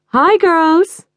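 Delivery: high, loud sing-song hello; cheery greeting. Acted. Model mother greets the girls with a high, loud hello. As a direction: call out a gushing hello.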